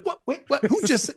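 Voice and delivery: high-pitched, flustered retold reaction; bewildered, pitch shooting way up; startled disbelief. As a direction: act out being caught totally off guard. Acting out shock at being volunteered.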